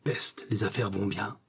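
soft, slow private thought; slow inward remark, kept quiet; aside to himself. A narrator's reading. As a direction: think it aloud slowly and softly. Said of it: "dit en lui-même le mercier".

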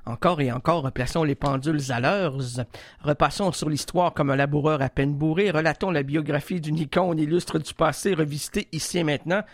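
low, grand announcer read; deep dramatic narrator voice; mock solemn intro. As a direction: intone it like a pompous documentary opener.

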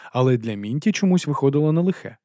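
plain reading voice

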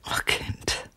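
a tired whispered aside; weary whisper. Acted. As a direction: breathe it out tiredly. Old woman dismisses the question.

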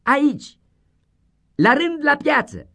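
high, loud chant of the pair; loud kids shouting; children calling out. Acted. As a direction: call out proudly.

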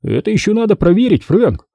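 high-pitched, insistent objection; objecting. A narrator's reading. Billy challenges his enraged boss, objecting. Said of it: "возразил Билли".

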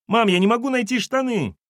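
put-on high boyish call to mom. A narrator's reading. Son calling his mother about missing pants. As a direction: yell for mom from the other room.

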